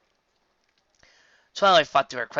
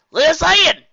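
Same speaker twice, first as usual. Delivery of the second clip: shouting; 16 dB louder, slower than usual. A narrator's reading. Shouting through door.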